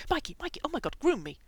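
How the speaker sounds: high, loud, urgent begging; feverish pleading. A narrator's reading. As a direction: beg frantically for relief. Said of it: "looking almost feverish".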